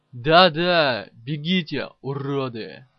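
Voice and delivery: mildly frenzied taunt, loud at the start; a touch of wild raging, easing off toward the end; raving. A narrator's reading. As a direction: jeer after fleeing enemies, opening on a shout. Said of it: "бесновался Каблуков".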